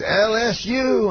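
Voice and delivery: high, slow stage call; Bill Cosby. Retold or imitated. Star names rival school to booing crowd, mimicked high slow call. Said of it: "every time slower".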